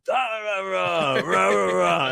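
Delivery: high-pitched wordless mock rant; mock yelling. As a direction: imitate scolding in gibberish, without real volume. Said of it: "I'm just yelling".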